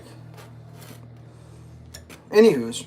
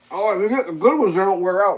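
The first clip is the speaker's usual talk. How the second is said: higher and louder, a snooty know-it-all voice; mocking the commenter; 5 semitones higher, 5 dB louder than usual. Mocking a commenter who says good boots never wear out, in a high snooty voice. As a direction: lampoon the commenter's claim, pitched up.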